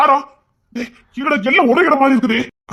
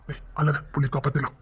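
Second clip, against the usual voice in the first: hushed; 12 semitones lower, 12 dB quieter than usual. Acted. Hushed comfort in danger.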